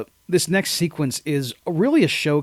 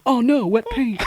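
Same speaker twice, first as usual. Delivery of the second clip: higher-pitched put-on lament; mock dismay; 7 semitones higher than usual. Cop noticing paint on himself, mock dismayed. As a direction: play up a silly little disaster.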